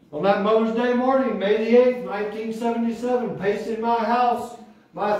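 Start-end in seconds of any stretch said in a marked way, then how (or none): none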